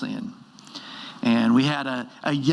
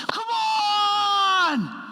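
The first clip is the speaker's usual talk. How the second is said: huge high shout to the crowd; fired-up shout. Retold or imitated. Freshman player celebrating to five hundred fans, roaring shout. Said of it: "he turns to the crowd and he goes".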